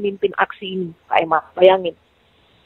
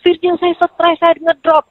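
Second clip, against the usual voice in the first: high-pitched imitation of his ranting; retold outburst; 8 semitones higher, slower than usual. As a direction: mimic his worked-up complaint, pitched up. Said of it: "awal dia ngamuk tuh". (menirukan keluhannya yang mengamuk, nada tinggi)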